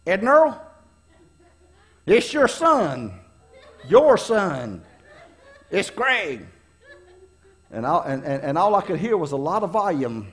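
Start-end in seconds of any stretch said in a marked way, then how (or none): 0.0-6.5 imitated parent's voice. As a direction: put on a parent calling out on the phone. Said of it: "all I heard was this voice".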